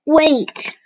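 put-on a little girl's cry to stop them; mild child's cry; tiny voice crying out. A narrator's reading. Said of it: "a tiny voice cried out".

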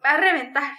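loud outburst full of hatred and despair; hateful, desperate cry. A narrator's reading. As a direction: spit it out in bitter rage. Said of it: "exclamó con voz llena de odio y desesperación".

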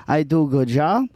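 lightly put-on Spanish accent. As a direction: play Juan, an immigrant worker with a light Spanish accent. Improv scene as Juan.

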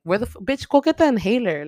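indignant outburst at a movie character; exasperated scolding. Acted. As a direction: scold her, voice jumping up. Scolds film character for disappearing.

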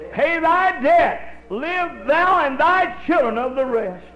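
high, loud preacher's cadence; preaching at full force. Acted. Preacher telling the congregation the oil miracle, loud and rising. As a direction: drive the story home from the pulpit. Voicing prophet's command.

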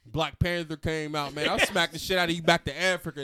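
mock-angry tirade, building to a yell; hammed-up threatening outburst; imitated rant. Imitates friend ranting at jitney driver.

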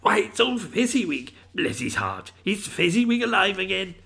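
high-pitched excited cry of recognition; thrilled old Scrooge, pitch leaping up; great excitement. A narrator's reading. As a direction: cry out in delighted astonishment. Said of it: "Scrooge cried in great excitement".

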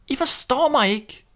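longing little duckling; duckling's voice. A narrator's reading. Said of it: "sagde ællingen".

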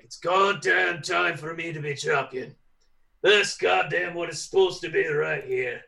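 grit out the demand to be champion; frustrated champion. Retold or imitated. Wrestler insisting it's his time, mildly gruff and determined. Voicing Drew wanting the title.

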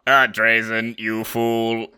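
mock robot voice on one held note; robotic Dalek. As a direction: parody a Necron as a monotone Dalek. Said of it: "they would go too robotic".

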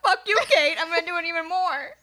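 high, loud mock defiance; spiteful. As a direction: spit it out in spite. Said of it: "like, spiteful".

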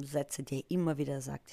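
ordinary delivery

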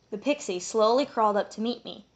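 ordinary delivery

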